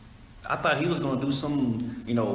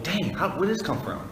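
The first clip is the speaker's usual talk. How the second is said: quick, higher, surprised "where did this come from"; a sudden happy surprise at the mailbox; 7 semitones higher, faster than usual. Retold or imitated. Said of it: "and you be like".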